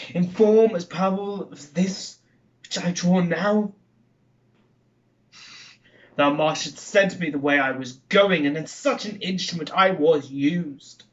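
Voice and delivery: theatrical Macbeth soliloquy, Scottish-tinged; haunted murderer wrestling with a vision of a dagger; dramatic verse recitation, rising to bursts of force; Shakespeare monologue. Acted.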